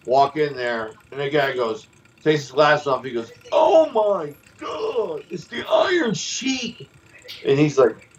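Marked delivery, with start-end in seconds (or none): 3.5-6.9 higher, slow amazed shout; starstruck shout. Retold or imitated. Bar owner spotting the Iron Sheik, slow astonished shout. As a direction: shout out the name in disbelief.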